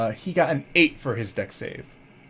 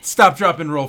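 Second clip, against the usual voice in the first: loud call; 4 semitones higher, 8 dB louder than usual. Acted. GM joking opening call.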